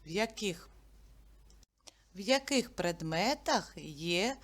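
ordinary delivery

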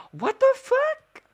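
high, loud, drawn-out squeal; stunned, slow shriek; real shock. Real, not acted. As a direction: cry out in disbelief after a gasp. Shocked at sudden game event.

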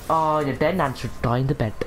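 normal talking voice

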